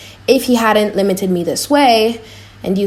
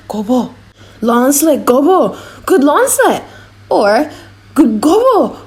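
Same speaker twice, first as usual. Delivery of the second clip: loud, higher coaxing devil; tempting devil; 4 semitones higher, 4 dB louder than usual. Acted. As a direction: egg him on like a devil at his elbow. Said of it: "He keeps saying".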